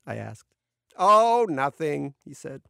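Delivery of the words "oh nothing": higher, drawn-out offhand reply; airy, stretched-out brush-off; breezy Oleg. A narrator's reading. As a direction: toss it off slowly in a higher voice.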